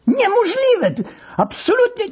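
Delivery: high, fast protest; shrill disbelief. Acted. Deputy denying accusations on the phone, high panicked protest.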